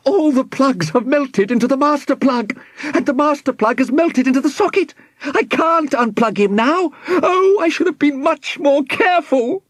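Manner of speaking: very high-pitched, distressed voice; panicking professor. A narrator's reading. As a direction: wail helplessly over your own mistake.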